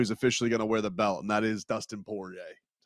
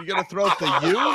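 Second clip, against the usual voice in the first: a high, louder put-on voice tossing out the dare; cartoonish teasing about throwing up the U; mock hype; 10 semitones higher, 6 dB louder than usual. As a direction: ham up the Miami fan taunt. Teasing about Miami fandom.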